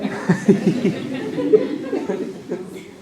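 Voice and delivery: high, loose laugh at his own joke. Real, not acted. Preacher laughing at his own joke, real laugh. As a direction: break into a laugh.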